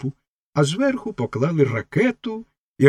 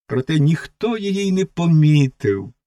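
plain reading voice

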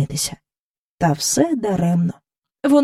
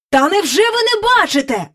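higher, exasperated call; agitated king; 11 semitones higher than usual. Acted. King calls to courtiers, much higher and agitated. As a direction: cry out in excitement. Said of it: "гукнув король".

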